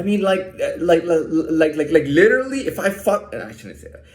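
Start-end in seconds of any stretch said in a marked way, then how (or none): none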